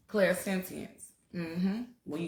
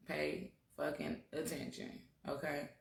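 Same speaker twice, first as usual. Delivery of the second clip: much softer, pressing "fucking" hard; hushed emphatic warning; 3 semitones lower, 13 dB quieter than usual. Real, not acted. Speaker warns viewers in a hushed, emphatic voice. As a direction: lean in and drop it to a hush.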